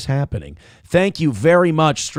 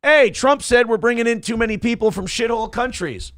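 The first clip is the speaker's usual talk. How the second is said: mock media alarm; 7 semitones higher than usual. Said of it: "the media went".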